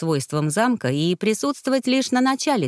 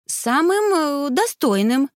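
high, emphatic answer with a small stumble; faltering insistence; 7 semitones higher, slower than usual. A narrator's reading. Younger sister falters naming her ideal groom, stubbornly insistent. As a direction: insist in a raised girlish pitch, catching midway.

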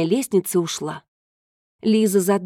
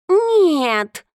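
dismissive; 9 semitones higher, 4 dB louder than usual. Acted. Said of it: "отмахнулся кот".

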